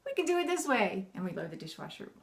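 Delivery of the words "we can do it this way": playful suggestion. Acted. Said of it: "Make it fun".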